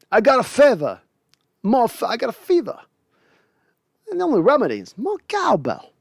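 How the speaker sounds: quoting the cowbell sketch as Walken; Christopher Walken impression. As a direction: lean into a Walken line read.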